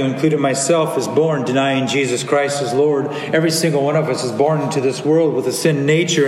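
usual speaking voice

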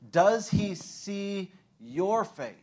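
very slow, every word weighed; slow searching question. Real, not acted. Preacher asking the congregation, slow and weighty. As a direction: let the question sink in. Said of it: "preacher slows for pause moment".

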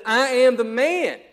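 slow, loud proclamation; triumphant loud cry; shouting out. Retold or imitated. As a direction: shout it out, drawn out and proud. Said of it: "the man shouts out".

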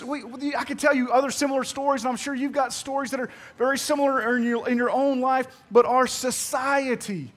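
ordinary talk, nothing marked

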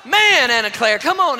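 loud, high exasperated call; frustrated shout. Retold or imitated. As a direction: plead in frustration while climbing. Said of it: "and I'm like".

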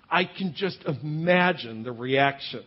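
ordinary talk

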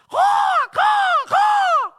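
loud high cawing like a crow. Retold or imitated. Preacher imitating a crow in the tree, loud cawing. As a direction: screech out a crow's caw. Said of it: "one of them go".

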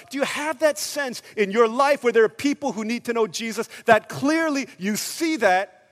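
loud, high, driving sermon; full-force preaching. Real, not acted. Preacher asking who would stay in prison, full-force preaching. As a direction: press the congregation with urgent questions.

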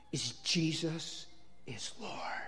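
slow, reverent whisper; whispered revelation. Retold or imitated. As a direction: whisper it slowly as the Holy Spirit's message. Voices the Holy Spirit's whispered message.

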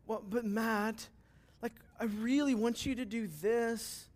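mock God begging like a sulky husband; whiny pleading God. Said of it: "songs that sound like that".

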